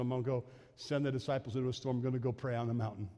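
low, soft and quick, as if thinking aloud; quiet aside. Retold or imitated. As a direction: murmur it quickly to yourself. Voices Jesus deciding.

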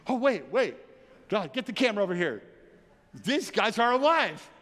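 startled and laughing, rising high and loud on alive; amazed onlooker. Retold or imitated. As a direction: play a gawker who can't believe his eyes. Onlookers discovering the revived bodies.

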